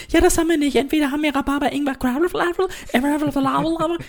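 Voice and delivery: high, put-on waiter voice, rattling off drinks; snooty waiter voice. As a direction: play a snooty hipster waiter. Mimicking a hipster waiter. (hochgestellte, affektierte Kellnerstimme)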